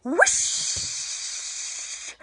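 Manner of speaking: long airy whoosh sound; breathy whoosh. Shown as a demonstration. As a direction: blow it out like wind. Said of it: "And another one".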